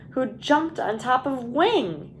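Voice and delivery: usual speaking voice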